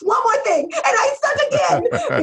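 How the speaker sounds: one last hammed-up wail of self-pity; whining crybaby. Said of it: "enough of this whining stuff".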